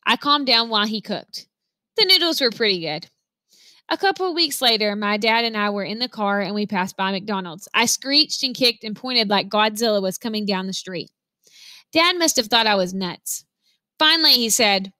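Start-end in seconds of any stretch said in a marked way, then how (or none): none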